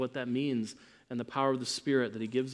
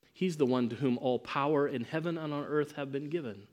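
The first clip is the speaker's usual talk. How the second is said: ordinary talk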